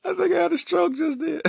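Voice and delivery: high, squeaky laugh-talk; cracked up, voice pushed high; real laughing. Real, not acted. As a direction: talk through helpless laughter at yourself. Cracking up after own wail.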